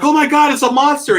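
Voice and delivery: high, loud mock panic; hammed-up townsfolk scream; scared townsfolk. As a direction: shriek it like frightened villagers. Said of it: "they're like".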